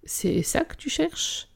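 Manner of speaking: high, soft breathy taunt; whispered taunt. Acted. Biker taunting her with her own gun, whispered and mocking.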